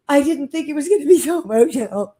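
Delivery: high, tearful imitation ending in loud fake sobs; hammed-up weepy whine breaking into a wail; mock crying. Woman mocking tearful filter users, mock crying. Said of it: "crying because they're seeing their younger selves".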